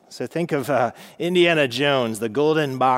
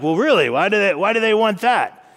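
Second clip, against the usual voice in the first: mock God voice; 7 semitones higher, 6 dB louder than usual. God playing along with Samuel.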